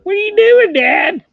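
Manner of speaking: high, loud squeaky dog voice; voicing the dog. Owner voicing his dog asking what Dad is doing, high and loud. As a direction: speak as the startled little dog. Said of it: "She's like".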